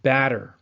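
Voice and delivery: Batter, loud and clear with an ah vowel; teacher models batter; example word. Shown as a demonstration. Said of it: "this ah sound for batter".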